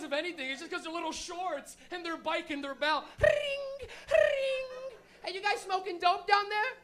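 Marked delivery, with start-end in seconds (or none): 3.2-5.0 high, loud trilled bell ring; imitated bike bell. 5.2-6.7 high, loud mock cop hail; bike cop calling out. Bike cop hails pot smokers.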